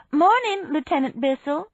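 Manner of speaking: high, loud hail; calling out. A narrator's reading. Woman hailing the arriving lieutenant across the yard, calling out. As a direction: sing out the greeting, pitched well up. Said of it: "I called".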